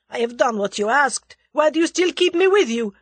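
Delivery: loud, high protest in character; protesting cry. A narrator's reading. The Russian protests at being held, crying out. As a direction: protest forcefully, rising in pitch. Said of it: "cried the other".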